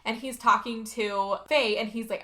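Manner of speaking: ordinary talk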